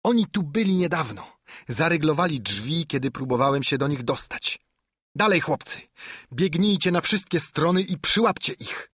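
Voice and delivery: rally the gang to give chase; agitated high-pitched orders; urging men on. A narrator's reading. Pew urges his gang to search.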